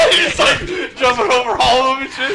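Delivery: excited shrieking. Acted. Imagining vampire leap.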